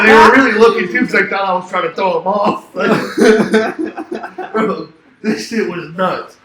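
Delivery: loud, high, bursting with laughter; laughing while talking. Real, not acted. Traveler recounting the suspicious bag search, cracking up. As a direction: crack up while telling it, then slow down to finish.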